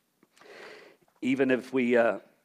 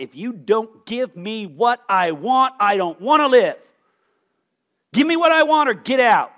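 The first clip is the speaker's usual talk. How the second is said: petulant demand; 5 semitones higher, 8 dB louder than usual. Retold or imitated. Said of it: "It is".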